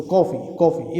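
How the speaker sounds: "कॉफ़ी" with a rounded open aw vowel, like coffee; English coffee sound. Shown as a demonstration. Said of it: "इस तरीके से बोलते हैं".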